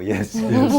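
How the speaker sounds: squeaky high, loud mock agreement; mock yes-man. Mocking staff who just agree.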